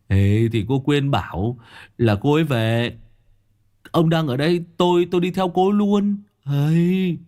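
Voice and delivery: high, gleeful buddy voice; gleeful Long. Acted. Long greets his bald old friend, high and gleeful. Long explains why he came.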